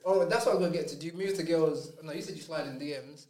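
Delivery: ordinary delivery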